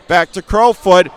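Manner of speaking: ordinary delivery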